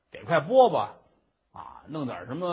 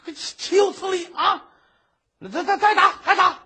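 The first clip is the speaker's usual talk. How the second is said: much higher, louder stammering rage; flustered judge shouting to beat him again; furious shout; 13 semitones higher, 8 dB louder than usual. Acted. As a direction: explode and order more blows. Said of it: "一说这急了".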